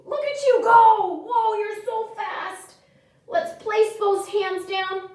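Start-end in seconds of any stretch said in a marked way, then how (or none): 0.0-2.7 excited. Real, not acted. Cheering on the children.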